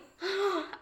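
a sharp high gasp, half sob; mock sobbing gasp. Telling a friend about a lonely month, gasp high. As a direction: gasp like you're about to cry. Retelling crying in Tijuana.

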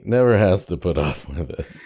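normal talking voice

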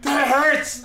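very high mock child's wail; pained little boy. Son cries to dad in bed, hammed-up pained child voice. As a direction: play up a kid's complaint to dad.

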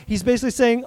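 usual speaking voice

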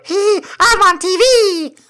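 put-on very high, squeaky kid's puppet voice; squeaky Elmo voice. Friend's kid imitating Elmo, in a squeaky high puppet voice. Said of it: "he had to go".

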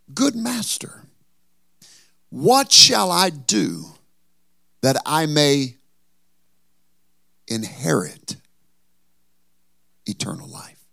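put-on pleading young ruler. Preacher voicing the young ruler.

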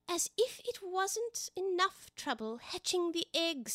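high, very slow lament; exasperated pigeon. Acted. Pigeon listing its failed hiding places, high slow complaint. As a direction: drag out each attempt in a high bird voice.

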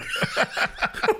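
high, unvoiced wheeze of laughter; breathless giggle; high wheezing laugh. Real, not acted. Laughing at bad writing.

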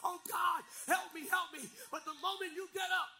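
loud, driving pulpit shout; full-force preaching. Acted. Pastor exhorting his church to stand firm, preaching at full force. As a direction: rouse the congregation, lifting pitch and volume toward the close.

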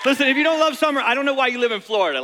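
loud, hyped call to the crowd; booming motivational speech. Real, not acted. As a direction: pump up the room. Crowd hype opening.

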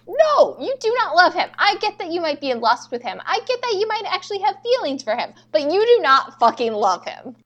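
high-pitched, insistent denial; emphatic scolding. Acted. Fan telling off Camilla about love, exasperated insistence.

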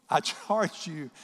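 slow, emphatic scripture reading; solemn charge. Acted. Preacher repeating the charge like a father, solemn. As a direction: stretch the words out firmly.

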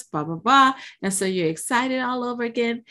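ordinary delivery

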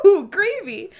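high, loud, laughing ooh; mock delight at the gravy; delighted Cassian. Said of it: "he was just like".

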